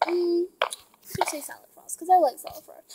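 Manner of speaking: soft secret whisper. Real, not acted. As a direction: whisper to yourself. Whispering while choosing map.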